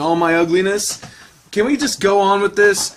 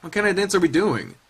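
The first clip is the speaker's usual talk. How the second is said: ordinary talk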